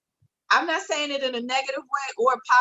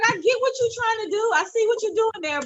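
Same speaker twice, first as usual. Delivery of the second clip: higher, laughing retort to an overeager mother; amused mock reply; 12 semitones higher than usual. To her mother about [name] backflips, high amused laughing retort.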